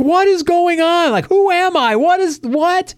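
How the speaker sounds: very high mock panic, words stretched; imitated freak-out. Player reacting to her own picture, imitated freak-out. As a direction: play her panicking in a sustained high squeal. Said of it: "And she's freaking out".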